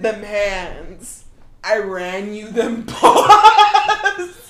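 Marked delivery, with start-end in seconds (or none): 1.6-4.5 laughing. Real, not acted. Punchline through laughter.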